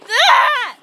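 shrill witchy cackle; witch cackle. Acted. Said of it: "cackle like a witch".

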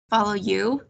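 plain reading voice

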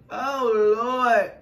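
real tears spilling over; crying out. Real, not acted. Reader calling out to God, crying. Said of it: "let me quit crying".

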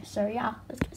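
quick unvoiced whisper. Real, not acted. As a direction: keep it a hushed whisper. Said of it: "I'm kinda whispering".

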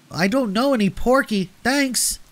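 put-on very high, squeaky small-child voice; little brother's high-pitched reply; little boy. A narrator's reading. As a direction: pipe it up like a five-year-old. Five-year-old Howie answers old man.

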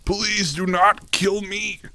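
high, slow, drawn-out begging; whiny plea. Acted. Pleading with the game enemy, high slow whine. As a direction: stretch the plea out like a whining child.

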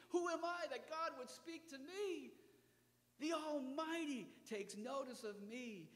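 high, amazed disbelief; awed wonder. Acted. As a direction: marvel at being noticed. Prophet's awed reaction.

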